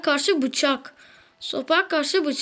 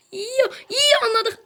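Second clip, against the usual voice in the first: whiny sulk; 7 semitones higher, slower than usual. Real, not acted. Grumbling after the opponent wins.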